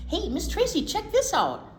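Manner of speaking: excited, like finding a treasure; excited call. Real, not acted. Excitedly shows book to co-host.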